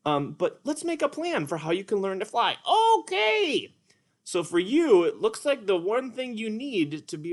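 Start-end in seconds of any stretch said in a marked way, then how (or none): 3.0-3.7 high, bright puppet character, eager; higher cartoon sidekick voice, eager; Petrie puppet voice. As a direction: play the little pterodactyl, keen to try.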